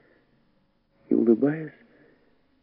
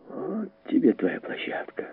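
ordinary delivery

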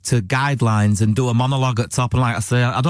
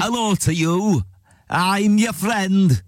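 higher-pitched, drawn-out character voice; slow, high comic impression; Avid Merrion; 5 semitones higher, slower than usual. Shown as a demonstration. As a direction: put on an odd friendly greeting, stretched out slowly. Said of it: "do an impression of Avid Merrion".

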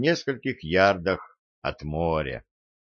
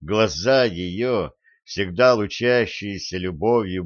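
ordinary delivery, nothing marked

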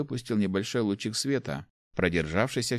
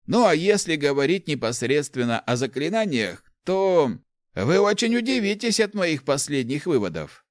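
put-on higher-pitched, animated old man's voice; enthusiastic old inventor; 7 semitones higher, 4 dB louder than usual. Old inventor explains artefacts to the rector, enthusiastic. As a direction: explain your discovery eagerly, pitched up.

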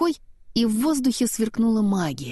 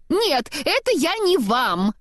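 high, slowed shout at his body; mock shout; 8 semitones higher than usual. A narrator's reading. Olaf shouting at his own body parts, high and slow. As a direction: yell after rolling body parts. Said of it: "закричал он".

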